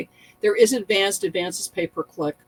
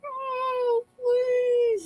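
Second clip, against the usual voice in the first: mock whimpering plea; 4 dB quieter than usual. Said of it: "I'm like".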